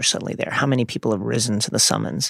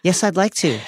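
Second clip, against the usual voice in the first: happy; 8 semitones higher, slower than usual. A narrator's reading. Luna happily agrees to take Harry, high and delighted. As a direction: burst out gladly in a high character voice. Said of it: "said Luna happily".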